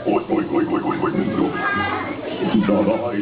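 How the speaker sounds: grunting pig noises then a refusal. Acted. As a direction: oink it out before saying no.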